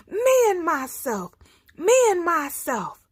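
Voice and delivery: loud, high roaring chant; proud roar. A narrator's reading. He lion marching toward Man, proud loud roar. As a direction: roar it like the king of the forest. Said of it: "He's roaring proud".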